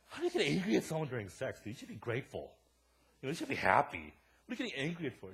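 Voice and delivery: exasperated, incredulous scolding of a coworker; retold indignant outburst; indignant challenge. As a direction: raise your voice in disbelief.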